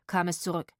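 ordinary delivery, nothing marked

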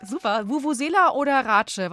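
loud, happy winner's cheer; excited cheer. Real, not acted. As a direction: shout the good news to the caller. Quiz caller wins.

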